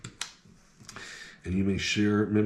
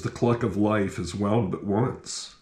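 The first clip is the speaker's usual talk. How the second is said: poem reading; 4 semitones higher than usual. Acted. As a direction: read the poem like at a funeral. Reads memorial poem aloud.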